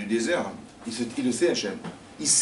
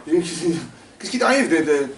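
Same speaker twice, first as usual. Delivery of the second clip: panicked; 6 semitones higher, 7 dB louder, slower than usual. Acted. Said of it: "Elle voit une araignée".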